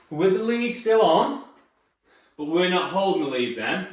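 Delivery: usual speaking voice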